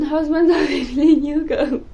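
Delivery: title said through real laughter; laughing. Real, not acted. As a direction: giggle your way through the title.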